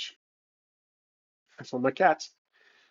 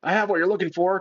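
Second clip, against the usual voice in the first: lightly acted, higher-pitched call; mock attempt at drawing attention; character's taunting call; 4 semitones higher, faster than usual. Player voices his hero distracting the villain, lightly acted call. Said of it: "the stupidest thing would be".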